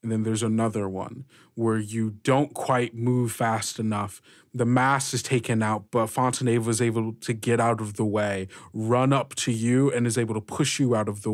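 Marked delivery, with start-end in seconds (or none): none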